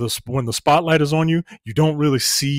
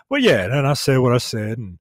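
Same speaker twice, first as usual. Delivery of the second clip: usual speaking voice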